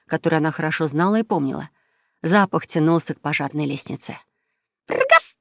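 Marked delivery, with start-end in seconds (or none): 4.9-5.3 high, rolled dog woof; imitated bark, pitched way up. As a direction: yap like a little dog. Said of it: "залаяла собака".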